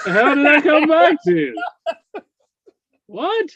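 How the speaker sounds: laughing disbelief. Real, not acted. Shocked by the fan remark.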